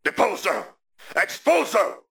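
high, urgent incantations; a battle cry climbing on the second spell; cried-out spell. A narrator's reading. Sirius attacks Rodolphus, high cried-out spells. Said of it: "cried Sirius".

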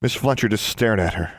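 ordinary delivery, nothing marked

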